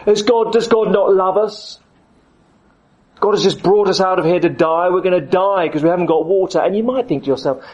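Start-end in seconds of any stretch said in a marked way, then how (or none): 0.0-6.6 moaning Israelites. Retold or imitated. Israelites complaining to Moses about no water, whining. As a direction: moan it like a grumbling crowd. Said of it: "they moan and moan and moan and say".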